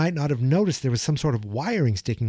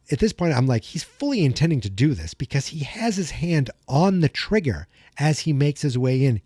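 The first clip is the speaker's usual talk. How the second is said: ordinary delivery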